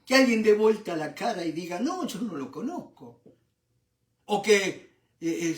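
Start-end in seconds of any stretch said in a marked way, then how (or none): none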